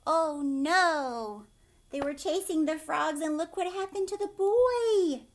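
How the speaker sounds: put-on sing-song excitement for young listeners; excited story reading. A narrator's reading. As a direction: bounce through it like a game with kids. Lively read-aloud of frog scene.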